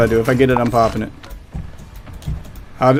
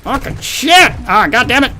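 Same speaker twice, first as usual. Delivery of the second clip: high, angry gamer outburst; real rage at the game; frustrated yell; 11 semitones higher than usual. Real, not acted.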